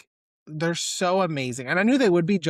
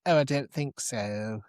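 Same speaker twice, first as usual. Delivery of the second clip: soft, slow, posh-ish British drawl done lightly; a light take on a breathy English gent; male Keira Knightley; 3 semitones lower, 3 dB quieter than usual. Retold or imitated. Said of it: "sounds like a male Keira Knightley".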